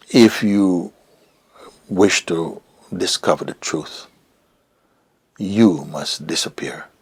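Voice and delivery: lightly voiced teacher, slow and deliberate; Papa G. Teacher telling the seeker what is required, slow pronouncement. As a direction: space out each phrase as a solemn instruction. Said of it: "what I heard that came through is him saying".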